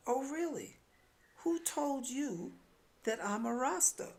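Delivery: high, slowed, arch disbelief; mock surprise. Narrator teasing the woman who assumes she smokes, arch mock disbelief.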